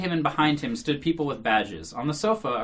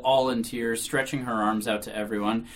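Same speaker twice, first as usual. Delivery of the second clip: usual speaking voice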